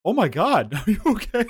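laughing alarmed question; laughing concern. Retold or imitated. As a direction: ask through a laugh, worried.

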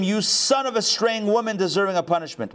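reading a king's rebuke in a high voice; mild, high-pitched indignation at a son; wrathful father. A narrator's reading. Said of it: "Saul's wrath was kindled against Jonathan".

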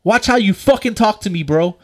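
retold angry challenge; confrontational. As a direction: warn him to back off.

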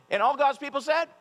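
high, loud rising call; call and response. Real, not acted. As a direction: call out to the congregation.

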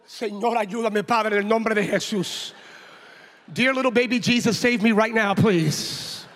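loud, urgent mock plea; hammed-up desperate appeal to heaven; panicked prayer. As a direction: pray aloud as if begging for rescue. Acted panicked plea to God.

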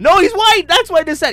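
high, loud, incredulous; loud exclaim. Real, not acted. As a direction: shout it in disbelief. Heated joke about terror suspect.

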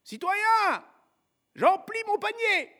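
put-on high-pitched boyish call; street urchin calling back. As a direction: answer cheekily from far across the street. Gavroche calls back from street.

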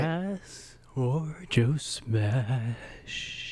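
extremely slow, stretched vowels; drawn-out announcement. Pretend. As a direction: stretch every word out like a segment jingle, with a long hiss at the end. Drawn-out announcement of running segment joke.

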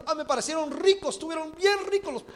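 mother's voice. Acted. Mother answers, ate the birds.

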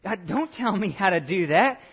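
mock rebellion. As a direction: push back like someone refusing an order. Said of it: "that rebellion that stirs back up in us and says".

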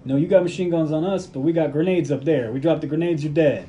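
acted standoff argument.

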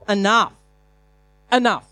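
loud, high "Enough" twice; forceful command. Shown as a demonstration. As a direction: bark out "Enough" to end it. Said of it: "it's a sign of saying".

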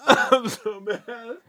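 high, drawn-out words through laughter; laughing in agony. Real, not acted. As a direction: laugh helplessly while suffering, very slowly. Laughing through the chip's burn.

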